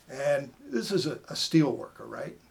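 ordinary talk

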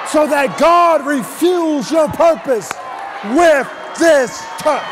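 loud, driving sermon with punched phrases; full-force preaching. Real, not acted. Preacher telling the congregation to touch their neighbor, full-force preaching.

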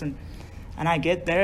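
ordinary talk, nothing marked

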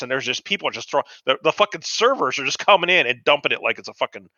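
ordinary talk

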